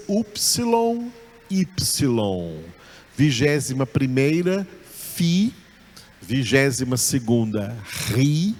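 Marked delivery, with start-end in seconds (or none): 0.1-2.6 upsilon then ypsilon, slowly, each name clearly set apart; two names. Shown as a demonstration. Teacher naming the letter that has two names, slow. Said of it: "que vale por duas".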